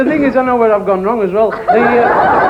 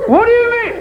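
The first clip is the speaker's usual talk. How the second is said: very high squawk; slipped into parrot squawk. Acted. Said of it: "he said".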